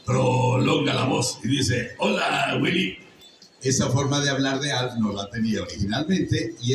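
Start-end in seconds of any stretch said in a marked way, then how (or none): 0.1-1.9 drawn-out rasp. Shown as a demonstration. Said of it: "le puse cómo se prolonga la voz". 2.0-3.0 raspy stretched alien greeting; the voice actor showing his own Alf greeting; Alf voice, drawn out. Shown as a demonstration. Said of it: "le puse cómo se prolonga la voz".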